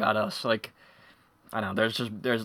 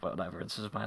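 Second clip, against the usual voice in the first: low, very soft shrug-off; retold unbothered kid; dismissive; 5 semitones lower, 13 dB quieter than usual. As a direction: toss it away, much quieter. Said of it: "but I was like".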